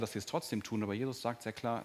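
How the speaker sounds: ordinary talk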